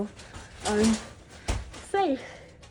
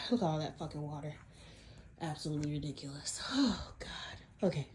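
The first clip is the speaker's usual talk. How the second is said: ordinary delivery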